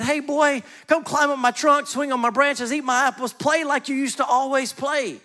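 a lightly voiced tree, excited to see the boy. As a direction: call the boy back to play, all eagerness. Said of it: "the tree was excited and said".

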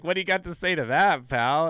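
imitating a gloating cop, quick loud taunt; mock taunt. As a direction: jeer it like a cocky winner. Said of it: "He's like".

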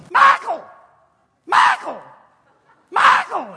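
high, shouted calls of a name; calling out a name. Retold or imitated. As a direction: yell for Michael like an eager fan. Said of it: "she's going".

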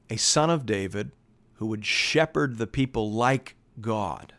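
ordinary talk, nothing marked

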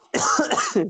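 breathy unvoiced cough; coughing fit. Real, not acted. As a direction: cough hard and dry mid-sentence. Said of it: "biraz öksürüyorum ama".

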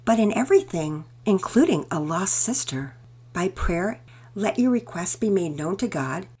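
lightly voiced mother, gentle then firmer; a mother reciting a verse to comfort; reassuring mother. Mother comforts, quotes scripture.

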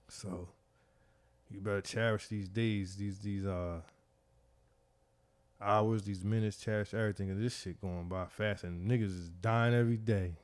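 low, subdued, heartfelt reflection; hushed and sobered; emotional. Real, not acted. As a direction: let emotion weigh the voice down softly. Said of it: "I got emotional".

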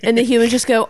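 ordinary talk

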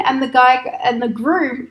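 usual speaking voice

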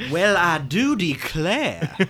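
slow, drawn-out mock Southern accent. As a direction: ham up a Southern gentleman accent. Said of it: "Wayne Dupree has a Cajun accent".

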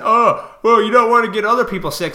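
high, loud, fast sneering mockery; mocking righteous critic. Ranting at critics, high loud sneering mockery. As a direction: sneer it like a sanctimonious scold. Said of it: "you think you're being righteous".

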